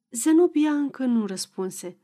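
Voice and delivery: ordinary delivery, nothing marked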